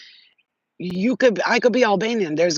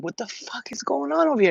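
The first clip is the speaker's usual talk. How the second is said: retold alarmed outburst; 8 semitones higher than usual. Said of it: "I'm like".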